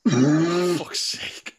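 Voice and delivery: loud, slowed laugh through the words; laughing exasperation. Real, not acted. Host fumbling the podcast outro, laughing loudly. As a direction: crack up while correcting yourself.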